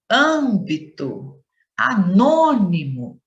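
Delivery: each word slow, third-last syllable drawn out and forceful; stress on antepenultimate syllable. Shown as a demonstration. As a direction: stretch the BÓ in "Abóbora", the NÔ in "anônimo". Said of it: "é pronunciada com maior intensidade". (antepenúltima sílaba forte e alongada, bem devagar)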